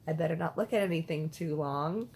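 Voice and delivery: normal talking voice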